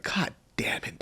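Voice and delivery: imitated curse under the breath; exasperated whisper. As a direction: whisper it as a fed-up mom. Irritated mom's muttered curse, imitated.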